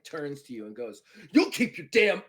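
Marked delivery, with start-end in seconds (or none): 1.3-2.2 loud, raised-pitch Klingon warning; angry threat. Acted. Klingon second turning on the officer, angry threat. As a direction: threaten them at high volume.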